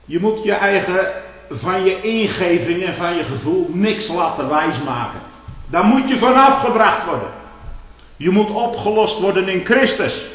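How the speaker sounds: preaching at full force. Acted. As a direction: lift the congregation with rising fervour. (luide, vurige preek)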